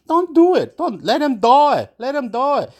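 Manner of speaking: high, exasperated retold argument; heated outburst. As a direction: snap back at mum, pitched right up.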